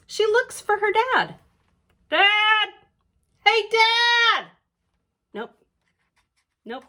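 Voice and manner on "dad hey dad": loud calling out, higher. Acted. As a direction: call across the house for Dad. Lena calls out for dad.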